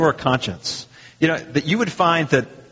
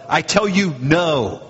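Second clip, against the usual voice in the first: firm, forceful rebuttal; very emphatic; slower than usual. Acted. Preacher voicing Jesus' reply to the question, very emphatic. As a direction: drive home the final NO. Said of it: "it's very emphatic".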